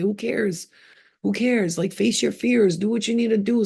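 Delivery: ordinary talk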